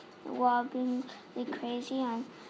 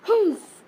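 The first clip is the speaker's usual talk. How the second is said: loud high humph of a snort; 9 semitones higher, 14 dB louder than usual. A narrator's reading. Headmistress taken aback, giving a loud snort. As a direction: snort at the pupil's success. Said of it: "she snorted".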